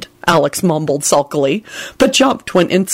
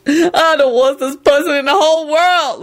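high-pitched tearful bawl; 9 semitones higher than usual. A narrator's reading. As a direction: bawl it out in self-pity. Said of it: "Lucretia bawled".

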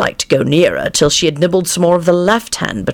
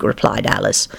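ordinary delivery

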